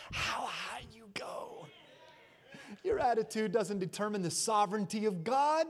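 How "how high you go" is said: sudden hushed whisper after shouting. Acted. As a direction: pull them in with a whisper.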